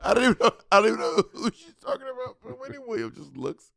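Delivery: ordinary delivery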